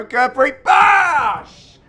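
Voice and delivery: high, loud shout with a long drawn-out bosh; excited shout. Real, not acted. Commentator calling a big shot, excited shout.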